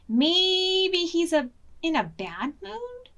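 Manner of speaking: high, slow bleating sheep. Acted. Sheep gossiping about the wolf, high and slow. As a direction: bleat it out slowly.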